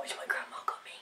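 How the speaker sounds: breathy whisper to camera; whispered aside. Real, not acted. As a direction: murmur it as an aside.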